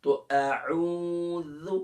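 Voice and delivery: أعوذ said slowly with the Arabic dhaal; drawn-out dhaal. Shown as a demonstration. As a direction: stretch أعوذ slowly, ending on a clear dhaal. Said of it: "यहाँ पर हमने क्या practice किया? दाल की".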